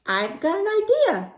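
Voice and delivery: high, eager pigeon voice; scheming pigeon. Acted. Pigeon suggests playing Drive the Bus, high eager voice. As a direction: pitch it high and drawn out, proposing a game. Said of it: "He says".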